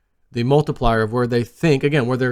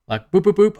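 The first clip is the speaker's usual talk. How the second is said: high, light boop noises, each one dropping; playful sound effect for a falling chart; bouncy boops; 6 semitones higher than usual. Retold or imitated. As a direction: tick the price down boop by boop. Imitating the price ticking down.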